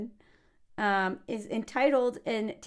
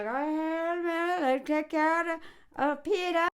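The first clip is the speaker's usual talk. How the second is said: higher, lightly voiced priest; a light mock priest; priest impression; 6 semitones higher, slower than usual. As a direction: drawl it like a chummy priest. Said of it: "the priest is all like".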